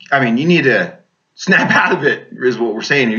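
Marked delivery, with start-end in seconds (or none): none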